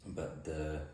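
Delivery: usual speaking voice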